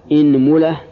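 إنملة as "inmula", i first, u after mim; kasra then damma. Shown as a demonstration. Teacher listing the kasra set, إنملة as "inmula". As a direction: say إنملة with opening i and middle u. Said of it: "كسر الهمزة".

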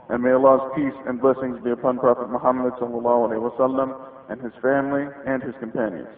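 normal talking voice

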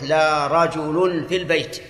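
"رجل" said rajulun, with the raf' ending; with the nominative ending. Shown as a demonstration. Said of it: "بالرفع". ("رجل" بالرفع: رَجُلٌ)

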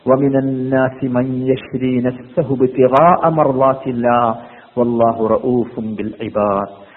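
slow, low Arabic recitation; Quran recitation. Real, not acted. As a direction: recite the verse low, lengthening the vowels. Quoting a Quran verse in recitation.

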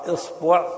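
"إصبع" as isbu', i then u; kasra on hamza. Shown as a demonstration. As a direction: say "إصبع" with i first and u after the b. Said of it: "إذا كسرت الهمزة فتقول". (نطق "إصبع" بكسر الهمزة وضم الباء)

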